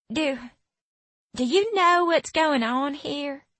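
high, hesitant Southern twang; Southern accent. A narrator's reading. Frightened girl asks the newcomer, high Southern accent. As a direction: stammer the start in a Southern twang. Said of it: "her voice twangy with a southern accent".

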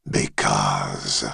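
very low, distant dragon voice; deep dragon voice. Acted. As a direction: speak as an ancient dragon, far below. Dragon head answers.

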